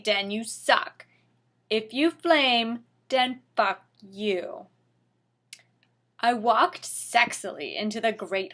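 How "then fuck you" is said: usual speaking voice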